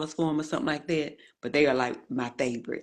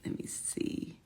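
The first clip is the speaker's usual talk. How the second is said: much softer whisper to herself; whispered aside; 12 dB quieter, slower than usual. Real, not acted. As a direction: breathe it out while searching. Muttering while checking screen.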